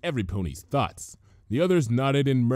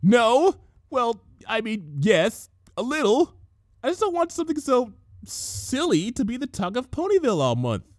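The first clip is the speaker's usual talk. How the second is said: flustered Twilight; 13 semitones higher, 3 dB louder than usual. A narrator's reading.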